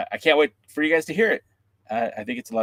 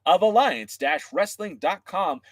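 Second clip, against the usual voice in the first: slow, high host pitch; upbeat wrestling podcast host read; podcast host intro; 8 semitones higher than usual. Acted. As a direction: sell the show to the fans.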